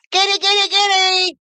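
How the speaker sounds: very high-pitched cat call. Real, not acted. Streamer breaks from greeting chat to summon the cat, calling in a high voice.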